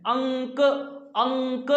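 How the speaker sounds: अंक with a long humming nasal before क; nasal dot sounded out. Shown as a demonstration. Teacher showing which vowel the dot joins in अंक, drawn-out nasal demonstration. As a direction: hold the अं of अंक, then release क lightly. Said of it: "किसके साथ लगी है".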